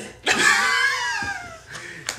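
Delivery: squealing burst of laughter; high shrieking laugh. Real, not acted. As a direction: cackle up high. Laughing at own accent bit.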